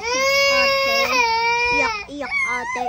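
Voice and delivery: a high, whiny pretend wail; mock crying. Child imitating her crying sister, whiny wail.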